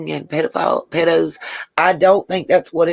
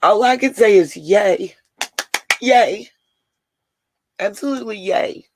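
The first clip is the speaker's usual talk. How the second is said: emotional, near tears; 3 semitones higher than usual. Real, not acted. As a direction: say "yay" as if holding back tears. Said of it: "I'm getting emotional and I apologize".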